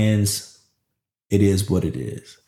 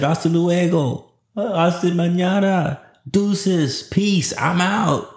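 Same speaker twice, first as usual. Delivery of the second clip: playful goodbye; 8 semitones higher, much slower than usual. Retold or imitated. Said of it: "I said".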